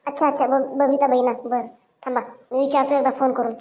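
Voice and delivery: usual speaking voice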